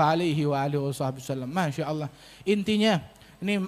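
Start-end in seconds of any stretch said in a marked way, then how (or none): none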